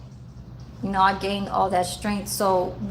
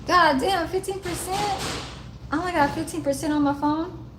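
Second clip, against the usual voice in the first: real surprised dismay; 7 semitones higher than usual. Real, not acted. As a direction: react aloud, pitched up in dismay. Notices low phone battery.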